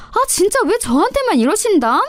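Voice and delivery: high, put-upon mock complaint; hammed-up sulky whine. A narrator's reading. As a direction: play the victim with a sneer. Daughter-in-law whines mockingly.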